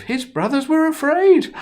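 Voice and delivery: high, loud alarmed telling; fearful. A narrator's reading. Narrator to children, the brothers' fear, high and loud. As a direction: sound the brothers' panic.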